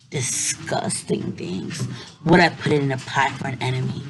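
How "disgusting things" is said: low, slow and quiet creepy. A narrator's reading. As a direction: savor the word disgusting. Spooky grossed-out aside.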